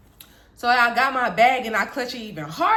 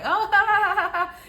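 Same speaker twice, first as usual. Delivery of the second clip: mocking laugh; 10 semitones higher than usual. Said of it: "He's like".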